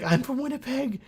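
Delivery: hammed-up high voice of an eager viewer; mock excited viewer. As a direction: squeak it like an overexcited fan. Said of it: "He's like".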